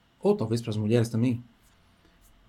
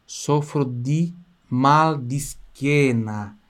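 each word drawn out very slowly; very slow demo; much slower than usual. Shown as a demonstration. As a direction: stretch every syllable for the student. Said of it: "teacher models the phrase". (bem devagar, palavra por palavra)

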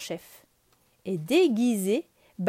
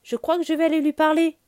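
put-on higher, louder, beaming boy; with a big smile; 7 semitones higher, 5 dB louder than usual. Mowgli eager to talk to the girl, beaming and loud. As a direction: say it grinning. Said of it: "avec un large sourire".

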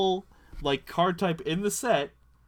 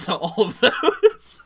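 laughing; 7 semitones higher, 9 dB louder, much slower than usual. Real, not acted. Laughing with the group.

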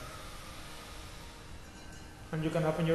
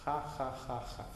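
soft ha ha; 4 semitones lower, 9 dB quieter than usual. Shown as a demonstration. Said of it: "In your head".

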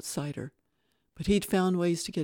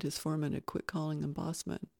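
ordinary delivery

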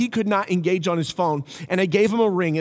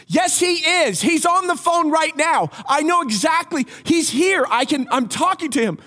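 retold yelling; 10 semitones higher than usual. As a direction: shout it at the cops, fed up. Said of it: "He starts yelling at the police officers".

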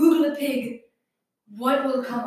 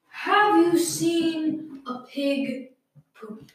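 slow dramatic; 3 semitones higher, much slower than usual. Real, not acted.

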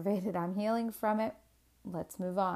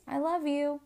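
lightly voiced loving child, higher; imitating young child; 7 semitones higher than usual. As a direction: say it a little high, like a child to Mommy. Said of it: "he kisses me on the cheek and he goes".

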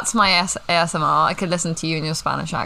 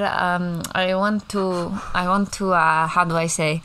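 ordinary delivery

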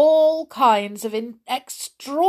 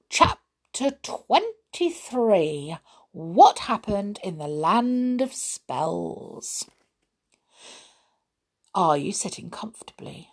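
ordinary delivery

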